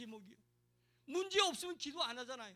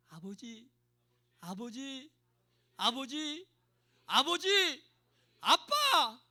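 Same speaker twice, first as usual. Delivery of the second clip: calling one's father; 13 dB louder, much slower than usual. Shown as a demonstration. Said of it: "자기 아버지 부르는 것처럼 아버지". (아버지를 점점 크게 부르는 외침)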